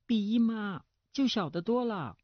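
put-on high girlish retort through a pout; pouting daughter. A narrator's reading. Daughter comparing herself with her aunt, pouting. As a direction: answer back like a sulky pampered girl. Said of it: "梅青撅起嘴唇回答".

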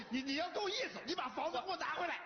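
high-pitched, flustered order; agitated demand. Acted. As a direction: stammer into an outraged fatherly demand. Father demands house back.

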